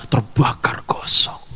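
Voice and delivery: slow ominous whisper. Retold or imitated. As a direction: breathe it out like a grim secret. Lecturer describes burning to death.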